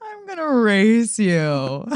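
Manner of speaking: teasing imitation. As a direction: mimic her childish dare. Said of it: "Were you dead serious?".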